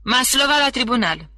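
guard shouts the prisoner's name through the door; summoning shout; calling out. A narrator's reading. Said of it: "și strigă".

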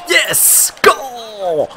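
very high, exultant yell; jubilant goal shout. Real, not acted. Gamer celebrating a power-play goal, jubilant yell.

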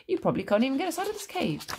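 quick, high, goading taunt; crafty teasing challenge; sly. A narrator's reading. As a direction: dare him slyly with a sing-song lilt. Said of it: "said Aladdin slyly".

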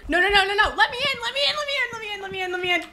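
high, frantic repeated begging; shrieky desperate plea; panicked pleading. Real, not acted. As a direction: plead in a rising panic, then ease down. Fleeing the ghost in game.

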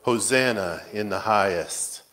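usual speaking voice

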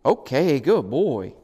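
loud, singsong praise to a puppy; excited puppy praise. Real, not acted. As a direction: cheer the puppy on in swooping tones. Praises puppy for ringing bells.

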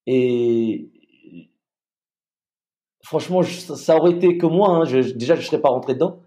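usual speaking voice

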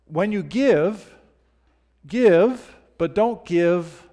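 high, loud and drawn out slowly; stretched, soaring pulpit delivery; preaching emphasis. Acted. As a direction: preach each give slow and ringing. Preacher stretching Jesus' words.

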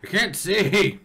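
high, loud cry of alarm; panicked shout. Acted. Panicked shout while thrown around.

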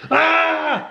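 put-on very high, excited child's "Ah!"; surprised squeal. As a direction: squeal like a startled, delighted kid. Toddler's surprised squeal imitated.

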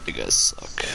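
whispered. Real, not acted. Whispered mock complaint.

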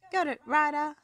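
high, loud snappy reply; barked reply. A narrator's reading. Skye answering Ryder over the radio, loud and brisk. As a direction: snap back a quick yes. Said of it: "Skye barked".

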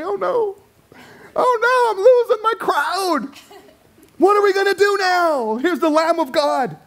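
high, mock-panicked wail; hammed-up fretting over losing the crowd. Panicked John voiced mockingly.

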